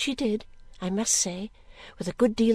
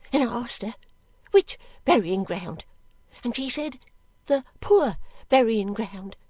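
higher, plaintive maid recounting events; earnest servant girl; 5 semitones higher than usual. Acted. Girl describing the burying ground to the lady, earnest. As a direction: tell it simply, in halting phrases.

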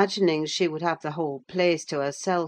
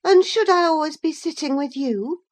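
put-on high, loud girl's retort; young Catherine; 12 semitones higher, 6 dB louder than usual. A narrator's reading. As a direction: challenge him in Catherine's high, raised voice.

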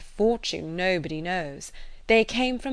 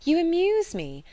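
high-pitched, amused exclamation; exclaiming; 10 semitones higher, 3 dB louder than usual. A narrator's reading. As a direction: exclaim it high, with mock astonishment. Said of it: "exclaimed Emma".

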